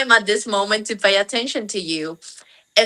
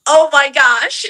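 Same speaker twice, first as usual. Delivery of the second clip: higher, louder exclamation; retold dismay; 7 semitones higher, 6 dB louder than usual. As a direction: re-enact a taken-aback mother. Retelling her reaction to daughters.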